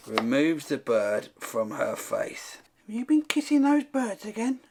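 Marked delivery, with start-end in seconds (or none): 2.9-4.6 high-pitched put-on accusing voice; mock scolding questioner. Someone quizzes the old lady about kissing birds, mock scolding. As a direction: question her in a high comic voice.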